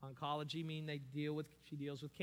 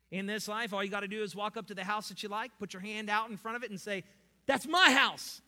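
mocking prosperity gospel; 6 semitones higher, 11 dB louder than usual.

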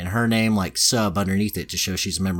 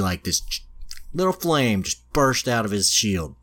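ordinary talk

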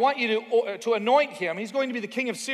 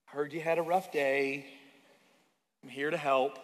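soft, low, gentle mom voice; a hint of a mother bringing cookies; mom's comfort; 5 semitones lower, 5 dB quieter than usual. Mom comforting her child softly. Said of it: "kind of like your mom".

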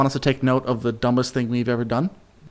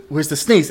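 pre-sneeze gasp; 2 semitones higher than usual. Retold or imitated. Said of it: "You're like".

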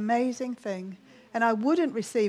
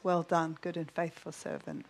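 soft, low words of approval; hushed, lowered praise; gentle commendation; 6 semitones lower, 7 dB quieter than usual. Retold or imitated. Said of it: "thing I heard in my mind".

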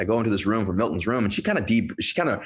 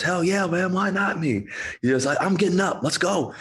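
excited patient; 10 semitones higher than usual. Retold or imitated. Patient suddenly fired up.

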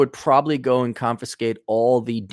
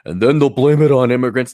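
mocking quote; 5 dB louder than usual. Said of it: "it was scripted that he had to say".

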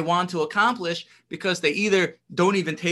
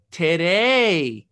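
"Today," louder, slow and drawn out, falling; stress on today; 5 dB louder than usual. Shown as a demonstration. Teacher stressing "Today," for students, loud and slow. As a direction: lean hard on "Today," and let it fall. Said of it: "Specifically emphasizing the word hayom".

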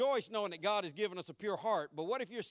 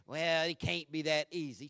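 mock doubter; 5 semitones lower than usual. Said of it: "This will get your mind to get".

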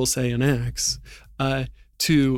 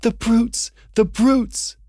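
put-on high girl's cry, tearful outrage, lightly done; Lucy's outraged cry; 9 semitones higher, 4 dB louder, slower than usual. Lucy watching Aslan mocked from hiding, high tearful cry. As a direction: cry it out as a young girl, heartbroken. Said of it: "tears streaming down her cheeks".

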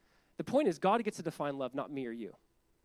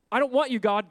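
defiant shout; 6 semitones higher, 8 dB louder than usual. Retold or imitated. Defiant sinner voiced.

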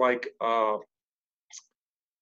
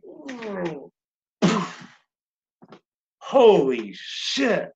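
high, stretched-out stunned reaction; re-enacted slow, blown-away exclamation; acted amazement; 6 semitones higher, much slower than usual. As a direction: let each exclamation swoop down in awe. Acting out stunned reaction to fill.

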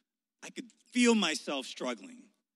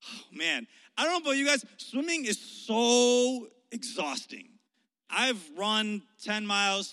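ordinary delivery

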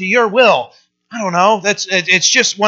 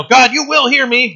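higher, pushy demand on God; mock demanding; 6 semitones higher than usual. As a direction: claim it like a command. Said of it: "it's not a command".

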